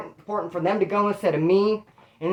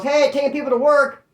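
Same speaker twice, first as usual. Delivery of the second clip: higher, louder cop's announcement; calling out; 7 semitones higher, 5 dB louder than usual. Retold or imitated. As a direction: call it out to the whole yard. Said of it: "He calls".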